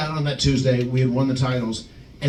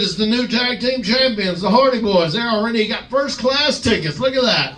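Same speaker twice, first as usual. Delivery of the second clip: much higher, sneering announcement; mocking JBL voice; 9 semitones higher than usual. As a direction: show off the rookies to the whole cabin.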